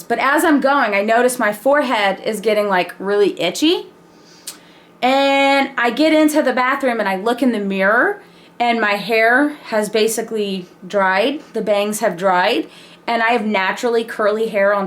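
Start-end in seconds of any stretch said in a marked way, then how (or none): none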